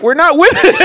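put-on little girls. Little girls refuse to join the fight, mock protest. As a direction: imitate girls backing out, high and loud.

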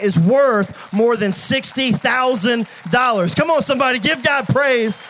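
rising preacher's cry of praise; preaching shout. Real, not acted. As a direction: call the church to praise at full voice. Preacher climaxing, crowd praise.

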